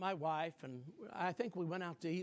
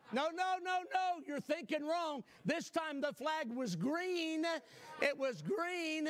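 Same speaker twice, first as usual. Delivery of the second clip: higher, slower, building to the punch; preacher's gleeful twist, drawn out; triumphant reveal; 7 semitones higher than usual. Acted. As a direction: savor the reveal, no no no.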